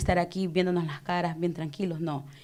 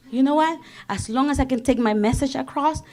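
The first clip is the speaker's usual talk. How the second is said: ordinary delivery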